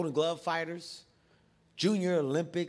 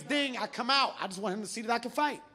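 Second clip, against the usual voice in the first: ordinary talk, nothing marked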